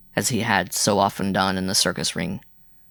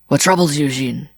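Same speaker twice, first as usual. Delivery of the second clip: breathless; 5 semitones higher, 5 dB louder than usual. A narrator's reading. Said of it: "asked a trifle breathlessly".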